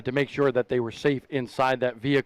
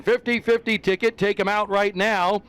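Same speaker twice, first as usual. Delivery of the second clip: booming stadium PA call; PA announcer voice; 4 semitones higher than usual. Pretend. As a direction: announce it like the public address. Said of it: "I'm gonna get my P A voice on".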